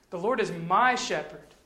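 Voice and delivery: "my" drawn out and punched; stress on my. Shown as a demonstration. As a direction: lean hard and slowly on the word "my". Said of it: "put the emphasis on a different word".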